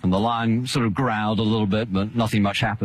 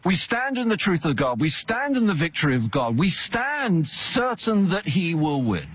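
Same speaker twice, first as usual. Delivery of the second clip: high, ringing pulpit proclamation; preaching; 11 semitones higher than usual. Acted. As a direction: proclaim it from the pulpit with full conviction. Preacher declaring to the congregation.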